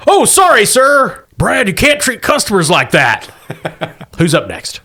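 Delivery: grovel to the customer like a manager; fussy shop manager. Acted.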